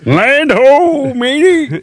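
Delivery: mock pirate call from the crow's nest; pirate lookout call. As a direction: sing out like a ship's lookout spotting land.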